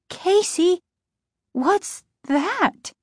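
high-pitched, hesitant alarm; high, apprehensive wondering; uneasy question. A narrator's reading. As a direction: ask haltingly at a strange sight. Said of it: "she asked, pointing".

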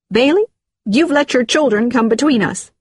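hurt accusation; upset reproach. Acted. Woman quarrels with her partner.

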